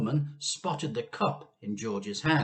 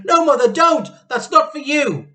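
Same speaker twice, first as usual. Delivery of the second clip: very high, loud pleading; frantic Missus Cranky; 17 dB louder than usual. A narrator's reading. Missus Cranky begs her mother not to drink. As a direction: beg her frantically to stop. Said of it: "cried Missus Cranky".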